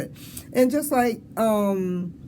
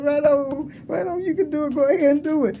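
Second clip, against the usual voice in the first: cheering on; 7 semitones higher than usual. Retold or imitated. Cheering students on.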